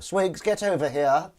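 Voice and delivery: high, loud, drawn-out villain summons; villain calling henchman. Acted. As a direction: call the henchman over, loud and slow. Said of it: "That wouldn't be Blofeld".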